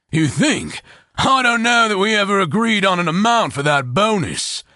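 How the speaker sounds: a hearty, amused sellsword; Horn's voice. A narrator's reading. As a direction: play Horn teasing his boss. Horn's character voice.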